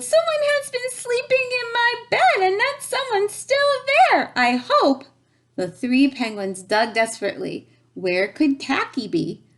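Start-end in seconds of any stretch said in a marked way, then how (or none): 0.0-5.0 very high squealing cub; Baby Bear squealing. A narrator's reading. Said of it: "And Baby Bear squealed".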